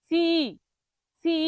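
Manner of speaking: each সি stretched to "si-i"; wrong-way demo of সি with an extra long i; common mispronunciation. Shown as a demonstration. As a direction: draw out the vowel of সি. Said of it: "আবার একটা রস্যই লাগায়".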